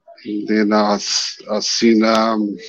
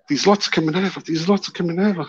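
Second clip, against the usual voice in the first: higher, insistent repeating; urgent excited; 6 semitones higher, 3 dB quieter, faster than usual. Retold or imitated. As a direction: keep pointing, urgent.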